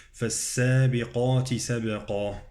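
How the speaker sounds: bouncing b then heavy qaf in "سبقا"; qalqalah, emphatic qaf. Shown as a demonstration. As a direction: recite "سبقا" with echoed b and dark ending. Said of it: "قاف مفخمة والقلقلة على الباء".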